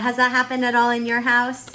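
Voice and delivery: normal talking voice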